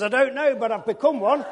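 loud, high, laughing through it; laughing reply. Retold or imitated. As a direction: answer with a laugh. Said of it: "I says".

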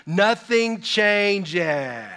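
NOTHING CHANGES stretched out very slowly; long, drawn-out emphatic declaration; emphatic punchline. Real, not acted. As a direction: draw the words right out for the congregation. Punchline to parents of young kids.

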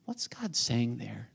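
slow, hushed question; whisper. Real, not acted. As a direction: draw them in with a slow whisper. Hushed rhetorical question to congregation.

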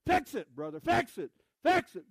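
loud, high call of fix it brother; imitating a congregant shouting encouragement; mock yelling. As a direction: holler it from the pews like an excited churchgoer. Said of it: "And he starts screaming".

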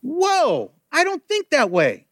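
high, awed exclamation; amazed whoa. Real, not acted. Preacher reacts to the story.

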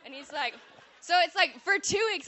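normal talking voice

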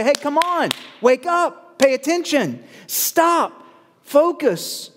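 high, insistent exhortation; punchy high commands, one after another; urgent wake-up call. Acted. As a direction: rouse someone who isn't paying attention. Voicing the Lord's wake-up call.

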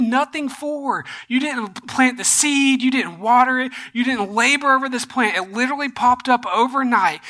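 acted-out exasperated confrontation; impassioned reproach. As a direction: pile up the reproaches, pressing each point. God confronts Jonah, acted out.

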